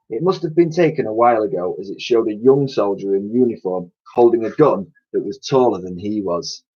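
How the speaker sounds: plain reading voice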